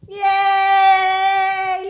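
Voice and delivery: loud, high drawn-out cheer; celebrating. Real, not acted. Celebrating with the child. (malakas na masayang hiyaw)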